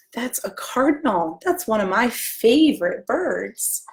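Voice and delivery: slow, gentle rhyme reading; reading to children. Acted. Librarian reading a picture book rhyme to children. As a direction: read the verse lovingly to little ones.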